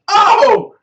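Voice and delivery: loud, high whoop of approval; sudden high shout of delight; real burst of excitement; excited exclamation. Real, not acted. Exclaims after reading the quote.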